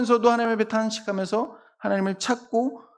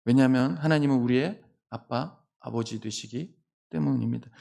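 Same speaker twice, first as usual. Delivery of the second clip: normal talking voice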